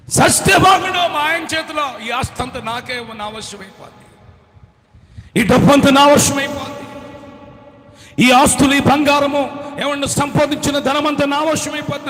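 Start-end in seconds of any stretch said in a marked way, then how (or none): 0.1-3.2 high, loud gloating wife; gleeful wish. Acted. Younger wife gloating over her rival, high and loud. 5.4-12.1 loud, high gloating; greedy glee. Acted. Younger wife crowing over the husband's wealth, loud.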